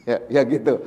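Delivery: ordinary talk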